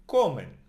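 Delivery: "comen" punched loudly on co; stress on penultimate syllable. Shown as a demonstration. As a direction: hit the first syllable of "comen", let -men fall. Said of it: "the N also belongs to the nose".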